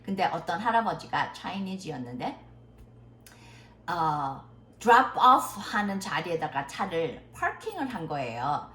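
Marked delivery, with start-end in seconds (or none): none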